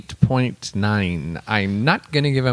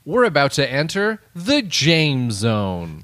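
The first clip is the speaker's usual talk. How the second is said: announcer voice; 4 semitones higher than usual. Pretend. Announcer intro, retry.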